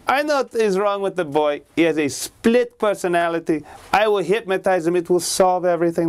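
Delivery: high, lightly put-on doctor voice; a smug expert sure of himself; confident psychiatrist. The psychiatrist assuring the family, high and smug.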